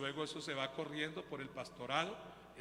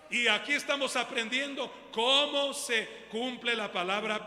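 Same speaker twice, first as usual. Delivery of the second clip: full-force preaching; 6 semitones higher, 9 dB louder than usual. Acted. Pastor to congregation, fiery full-force preaching.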